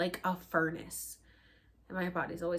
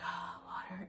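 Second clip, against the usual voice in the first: whisper; 10 dB quieter than usual. Retold or imitated. Mock relief at the water.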